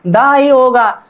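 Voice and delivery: normal talking voice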